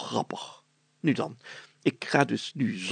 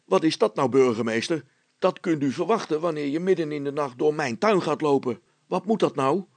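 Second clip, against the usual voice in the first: loud, raised-voice man scolding the mayor; indignant neighbour shouting at the mayor for crossing his garden; angry homeowner; 4 semitones higher, 3 dB louder than usual. Acted. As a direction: tell off the night intruder, higher and louder.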